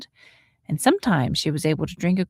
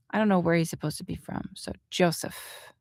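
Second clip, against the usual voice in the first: usual speaking voice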